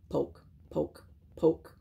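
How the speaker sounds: small, soft, separated pokes; soft poking words. A narrator's reading. Narrator acting out the bear poking Joe, soft and spaced. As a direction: say each poke quietly on its own.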